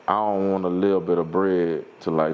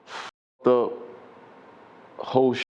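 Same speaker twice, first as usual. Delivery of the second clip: normal talking voice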